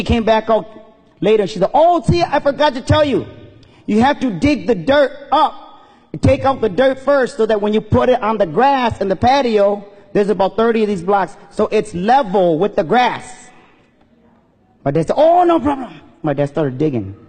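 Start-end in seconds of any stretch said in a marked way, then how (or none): 1.7-13.5 higher-pitched imitation of a lady giving orders; lilting, raised voice for an imitated lady; lady homeowner. Lady returns with more instructions. 15.2-15.9 high-pitched, obliging mock reply; agreeable dad. Dad cheerfully agreeing to more work.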